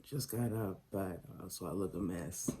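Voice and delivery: low, groggy just-woke-up voice; morning voice. Real, not acted. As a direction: sound freshly out of bed. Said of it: "I still got my morning voice".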